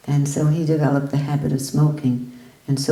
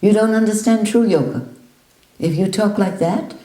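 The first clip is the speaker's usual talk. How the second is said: loud, higher rebuke; scolding teacher; 6 semitones higher, 4 dB louder than usual. Retold or imitated. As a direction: tell him flatly he's wrong.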